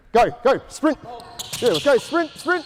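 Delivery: high, urgent calls across the court; calling out. Real, not acted. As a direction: shout them into sprinting. Coach calling out during drill.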